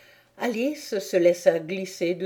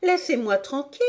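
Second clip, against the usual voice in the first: high-voiced demand to be left alone; high, protesting child; 7 semitones higher, faster than usual. A narrator's reading. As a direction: push back as an indignant girl. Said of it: "protesta Alice".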